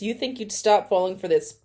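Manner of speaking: ordinary delivery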